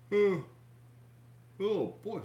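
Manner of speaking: big yawn through the words. Real, not acted. Yawning mid-stream to his viewers. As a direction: stretch the words out in a loud yawn. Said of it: "Big yawn".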